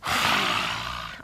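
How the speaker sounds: long breathy lion's breath out; lion's breath exhale. Shown as a demonstration. Yoga teacher showing a child lion's breath, breathy exhale. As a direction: push the breath out like a lion. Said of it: "Let's do one more".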